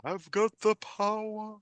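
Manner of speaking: high, slow heroic cartoon battle cry; He-Man. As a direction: proclaim it like a cartoon hero. Quoting He-Man while discussing movie.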